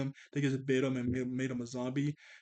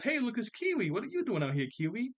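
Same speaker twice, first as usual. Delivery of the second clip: high, surprised greeting; surprised call; 8 semitones higher than usual. Retold or imitated. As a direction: call out to the dog. Voicing himself spotting the dog.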